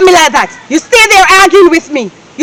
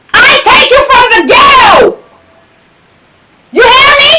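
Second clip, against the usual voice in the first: shouted higher, hammering each word; yelling; 6 semitones higher than usual. Acted. Wife yelling at husband.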